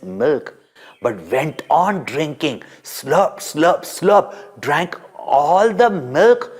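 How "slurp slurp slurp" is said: mock slurping noises, rising; playful slurp slurp slurp; slurping sounds. A narrator's reading. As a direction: slurp it out like a greedy baby. Baby drinking poison milk.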